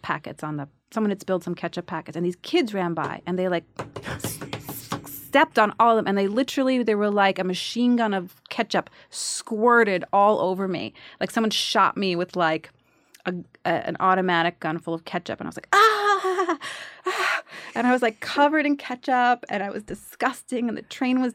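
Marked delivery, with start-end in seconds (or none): 4.1-4.9 hissed squirt sound effect; soft, sputtering spurts; squirting noise. Retold or imitated. Imitating ketchup packets bursting. 15.7-17.4 high, drawn-out mock scream; two high cries of comic horror; acted scream. Scream when squirted, acted out.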